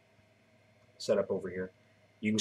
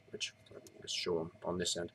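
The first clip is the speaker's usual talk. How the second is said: whispered aside; 3 semitones lower, 12 dB quieter than usual. Real, not acted. Whispered aside while working.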